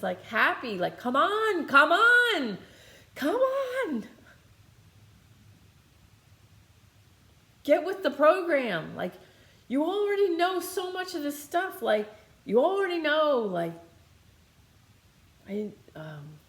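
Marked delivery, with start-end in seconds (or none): 1.0-9.0 high, loud, cheerful urging; happy yelling. Retold or imitated. Spirit guide cheering the speaker on, high happy yell. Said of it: "she's yelling, but she's happy". 9.7-13.4 high, loud, cheerful urging; happy yelling. Retold or imitated. Spirit guide cheering the speaker on, high happy yell. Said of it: "she's yelling, but she's happy".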